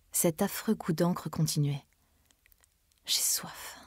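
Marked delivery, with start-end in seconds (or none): 3.1-3.8 faint, breathless whisper of thirst; sighing whisper. A narrator's reading. Dying Emma asks for water, whispered sigh. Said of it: "soupira-t-elle".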